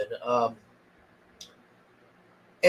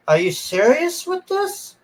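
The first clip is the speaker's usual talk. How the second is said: much higher, disbelieving mock question; incredulous; 11 semitones higher than usual. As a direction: ask it like you can't believe what you're watching. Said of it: "I'm just like".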